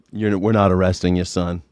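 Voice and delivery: low, deadpan cop voice; mock cop. Cop turning down the shooter, low and deadpan. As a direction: drop the pitch, flat dismissal. Voicing the cops.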